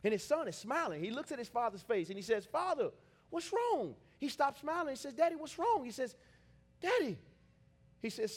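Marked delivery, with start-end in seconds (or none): 2.5-4.0 higher, slow, drawn-out plea; worried son calling to his father. Acted. 5.1-5.8 high, louder questioning cry; anxious child's plea; worried son. Acted. Son asks crying father. 6.8-7.2 worried son. Acted. Son calls to his father.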